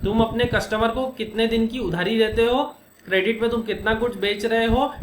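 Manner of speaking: ordinary talk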